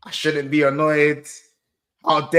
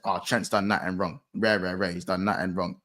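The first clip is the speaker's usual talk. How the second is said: dismissive quote; 12 semitones lower, 5 dB quieter than usual. Retold or imitated. Quotes defenders' excuses dismissively.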